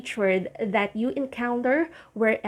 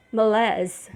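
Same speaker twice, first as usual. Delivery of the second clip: malaise in French, muh-LEZ; French malaise, open LEZ ending; French pronunciation. Shown as a demonstration. Teacher contrasting French pronunciation of malaise. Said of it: "the French pronunciation for this word is".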